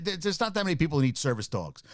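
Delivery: ordinary talk, nothing marked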